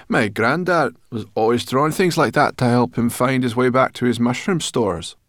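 high, loud boasting character voice; self-important. A narrator's reading. Sorrel boasting of granddad's drawings, self-important. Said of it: "looking important".

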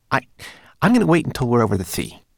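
fast, high, flustered voice; nervous homunculus. Acted. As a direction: blurt an excuse in a rush. Twigleg stammers an excuse.

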